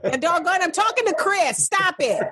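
high-pitched exasperated scolding; scolding the dog. Real, not acted. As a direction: plead with a noisy pet to quit. Scolding barking dog mid-call.

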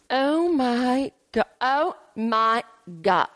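slow, stunned gasp of disbelief; shocked. Real, not acted. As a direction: react as if caught off guard. After a gasp.

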